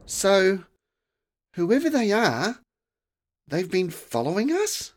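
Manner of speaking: put-on high, startled girl voice; a girl caught off guard; alarmed girl. A narrator's reading. Girl asks as frost spreads, high and alarmed.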